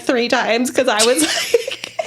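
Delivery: ordinary delivery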